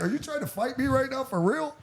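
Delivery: high, amused incredulity; mock him with disbelief; laughing disbelief. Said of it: "I start laughing at him".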